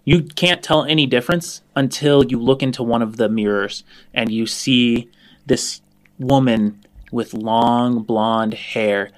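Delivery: ordinary delivery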